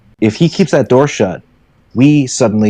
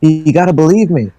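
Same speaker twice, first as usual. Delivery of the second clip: higher, pleading fast at the end; desperate pleading; 7 semitones higher than usual. Acted. George pleading with the crowd, higher desperate voice. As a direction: beg them to believe you. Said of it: "And George is going".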